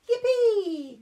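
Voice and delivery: very high loud laughing cheer; squealed yippee; excited yippee. Acted. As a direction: cheer with delight. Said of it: "cried Peppa".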